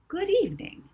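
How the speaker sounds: high, bright polite greeting; a sweet flight attendant welcome; polite air hostess voice. Acted. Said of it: "she said politely".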